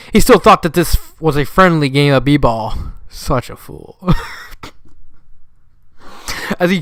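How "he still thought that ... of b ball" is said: usual speaking voice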